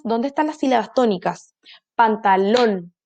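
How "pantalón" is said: slow Pantalón, last syllable leaned on. Shown as a demonstration. Teacher sounding out Pantalón for children, slowly. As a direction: draw out Pantalón ending on lón. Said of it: "última sílaba".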